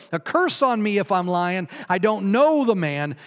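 loud, high, cursing insistence; desperate sworn denial; swearing denial. Acted. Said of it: "Peter swore".

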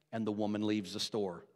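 normal talking voice

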